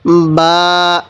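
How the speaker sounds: বা begun with both lips pressed together, vowel held; teacher's drawn-out demo of বা; two-lip articulation. Shown as a demonstration. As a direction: press both lips, then open into a long বা. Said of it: "দুই ঠোঁট থেকে উচ্চারণ করব".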